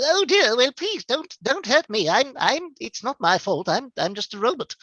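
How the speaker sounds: high, pleading fussy robot; Fugitoid. Acted. Robot begging the general not to hurt him, high flustered voice. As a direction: plead anxiously, stammering like a frightened droid. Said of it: "The Fugitoid".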